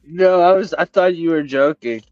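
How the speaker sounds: normal talking voice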